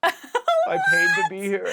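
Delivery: very high, loud, drawn-out wail; repeated tripping mantra. Retold or imitated. Retelling own mid-trip self-reminder, high hammed-up wail. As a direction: wail it slowly at the top of your pitch, hammed up. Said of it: "apparently I kept saying".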